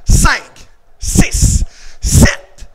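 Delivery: loud, punched-out numbers; forceful drill count. Real, not acted. Coach counting blocks for pupils, forceful shout.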